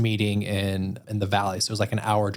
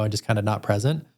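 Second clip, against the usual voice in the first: normal talking voice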